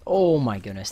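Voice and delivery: loud disgusted exclamation; shocked shout. Real, not acted. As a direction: blurt it out in shock. Shocked by a gross play.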